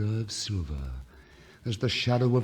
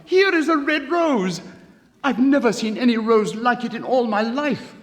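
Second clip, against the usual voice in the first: high, loud and fast delight; excited cry; 13 semitones higher, 9 dB louder than usual. A narrator's reading. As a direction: burst out with glee at the window. Student delighted at the rose.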